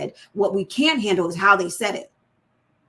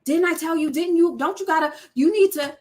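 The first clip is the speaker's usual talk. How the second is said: put-on higher, nagging scold at a child; mock nag, pitched up; nagging parent; 6 semitones higher than usual. Shown as a demonstration. Parent nagging the children, pitched up scold. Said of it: "when we nag our children".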